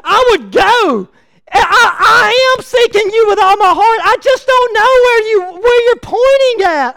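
a hint of a desperate pleading prayer. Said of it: "you would say".

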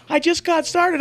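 high, loud imitation of an indignant daughter; protesting kid. Daughter told it's time to go, high imitated protest. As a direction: object as a kid not ready to quit.

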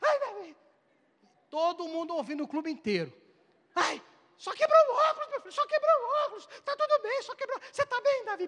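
high, fussing overprotective mother. Retold or imitated. Preacher playing the overprotective mother fussing over her son, high and anxious. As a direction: coo over the boy in a high panic. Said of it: "Aí chegou no menino".

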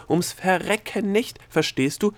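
high, drawn-out exclamation; exasperated cry. A narrator's reading. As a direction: cry out in frustration, dragging the words. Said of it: "rief Delia".

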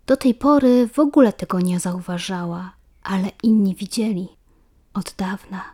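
usual speaking voice

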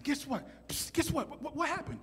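high, soft, secretive bedtime voice; excited kid keeping it down; hushed confiding. Retold or imitated. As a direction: share a secret after lights out. Brothers whispering secretly at bedtime.

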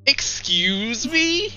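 slow high indignant excuse me; mock offended. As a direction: play it scandalised, drawn out. Mock offense at friend's joke.

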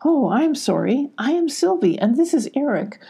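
high, sing-song politeness; eager apology. Acted. Sylvie apologising to the goats, high and eager.

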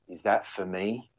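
soft, slow, bewildered question; injured skier staring at the helicopter, soft and slow; dazed patient. Retold or imitated. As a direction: ask it quietly and slowly, half out of it. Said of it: "he goes".

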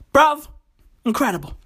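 ordinary talk

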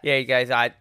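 usual speaking voice

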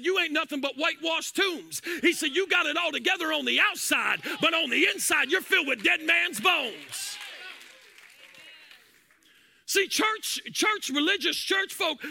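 rising, shouted preaching over music; full-force preaching. Acted.

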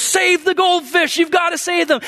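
high, pleading cry to save them; urgent call. Retold or imitated. Pastor urging kids to save goldfish, high and urgent. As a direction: urge the kids to rescue the fish. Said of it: "the pastor goes".

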